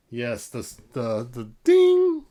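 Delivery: plain reading voice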